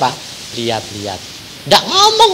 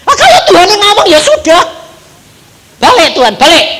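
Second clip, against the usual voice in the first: mock exasperated boss; 12 semitones higher, 7 dB louder than usual. Voicing the director's reply.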